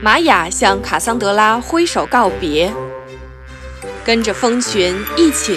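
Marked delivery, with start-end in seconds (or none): none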